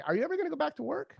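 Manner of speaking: high, fast teasing wife voice; quoting wife. Retold or imitated. Husband quoting his wife nagging him about work, high and quick. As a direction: rattle it off high like a nudging wife. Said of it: "my wife said one day".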